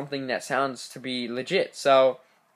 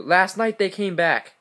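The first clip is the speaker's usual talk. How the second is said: louder, higher, slow distressed insistence; acted distress, raised voice without real sobbing; hysterical caller, acted; 6 semitones higher, 6 dB louder, slower than usual. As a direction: cut in with mock-desperate insistence, drawn out. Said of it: "crying hysterically right now".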